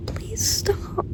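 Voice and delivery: high, soft desperate whisper; a whispered please. Real, not acted. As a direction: beg quietly, almost whimpering. Begging, repeated.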